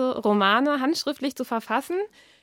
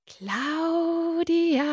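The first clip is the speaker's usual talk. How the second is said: much higher, drawn-out eerie call; unearthly voice; 7 semitones higher than usual. Acted. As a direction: call her name again, stronger and more otherworldly. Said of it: "Die Stimme klang seltsam unmenschlich".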